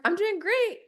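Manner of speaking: high-pitched, upbeat answer; retold perky small talk; acted chipper reply. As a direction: answer brightly, voice lifted high. Her acted reply to the greeting.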